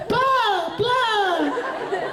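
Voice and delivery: two high squealed nonsense syllables; high-pitched mimicked mouth flaps. Shown as a demonstration. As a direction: squeal out two drawn-out blahs. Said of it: "It's two flaps".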